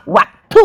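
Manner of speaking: a contemptuous spitting noise; disgusted spit sound. Real, not acted. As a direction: spit the words out like you're gagging.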